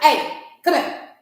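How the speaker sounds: loud, high, slow mimicry of her summons; rude summons. Lady summons a parent at the door, imitated rude summons. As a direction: imitate her rudely ordering someone over. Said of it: "she was just rude".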